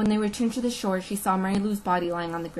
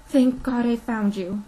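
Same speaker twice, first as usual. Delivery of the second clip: slow, louder relief; relieved sigh; 3 semitones higher, 6 dB louder, slower than usual. A narrator's reading. Teacher finds her sweetheart at the lake, sighing with relief. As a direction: let the urgency show through relief. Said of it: "she sighed".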